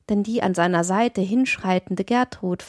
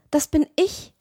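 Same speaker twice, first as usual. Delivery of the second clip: put-on a girl's quick startled call, higher; startled cry; 7 semitones higher than usual. A narrator's reading. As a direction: blurt it out, half frightened. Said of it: "rief Gertrud rasch und fast erschreckt". (rasch und fast erschrocken, höher)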